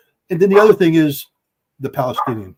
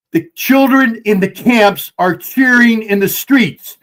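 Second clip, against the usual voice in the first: loud, higher, slow and emphatic; all wound up; 5 semitones higher, 6 dB louder, slower than usual. Real, not acted. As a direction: hammer each word, raised voice, drawn out. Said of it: "when Daddy starts getting all wound up".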